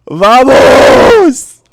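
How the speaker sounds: very high, loud roar of Vamos; victory shout. Real, not acted. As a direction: roar it out in triumph. Celebrates the result.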